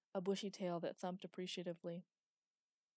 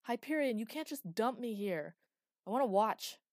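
loud, higher, insistent call; loud demand; 4 semitones higher, 10 dB louder than usual. A narrator's reading. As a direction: demand it loudly of the old god. Villain demanding of a god.

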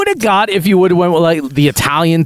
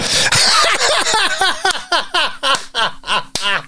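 long, high-pitched cackling laugh; real helpless laughter in a long run; 14 semitones higher, 4 dB quieter than usual. Real, not acted. Laughing at own nickname.

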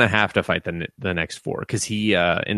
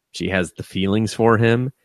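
ordinary talk, nothing marked

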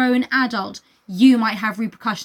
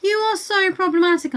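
mock scolding; 8 semitones higher than usual. Acting Scout's retort to uncle.

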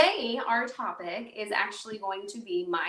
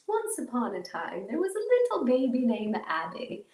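fairy tale narration; 3 semitones higher than usual. Pretend. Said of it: "I'm just kidding".

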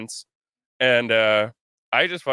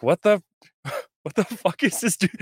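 incredulous; 8 semitones higher than usual. Retold or imitated. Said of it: "I'm like".

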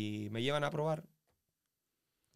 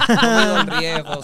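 much higher and much louder, laughing it out; 10 semitones higher, 20 dB louder, slower than usual. Real, not acted. Joking to the host about danger, loud laughing. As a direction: crack up mid-boast.

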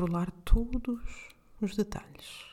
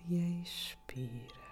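calm guide with long pauses between breaths; guided meditation; 4 dB quieter than usual. Acted. (meditação guiada, voz suave e lenta)

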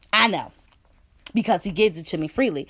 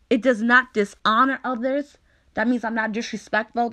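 ordinary talk, nothing marked

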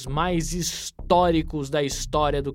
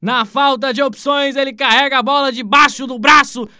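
rising to a shouted save, louder; live play-by-play; 3 semitones higher, 8 dB louder than usual. Acted. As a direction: call the chance and the save.

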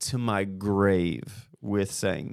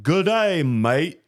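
higher, put-on Australian greeting; mock Australian accent; 5 semitones higher, 3 dB louder, much slower than usual. Shown as a demonstration. Showing what his accent isn't, mock Australian. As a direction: exaggerate a stock Australian accent. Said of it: "it's not like".

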